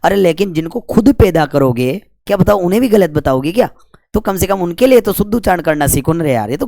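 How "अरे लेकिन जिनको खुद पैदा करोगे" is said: ordinary talk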